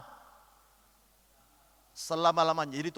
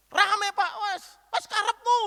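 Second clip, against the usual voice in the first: higher, louder Javanese brush-off in a spat; exasperated marital shout, much higher; mock quarrel yell; 12 semitones higher, 3 dB louder, slower than usual. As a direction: toss it off like a fed-up spouse. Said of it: "sudah teriak-teriak".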